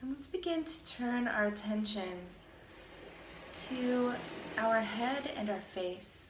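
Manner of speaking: calm, even meditation voice with long pauses; guided meditation. Acted. Teacher guiding listeners through a body scan, calm meditation voice. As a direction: lead a body scan, unhurried, leaving space between phrases.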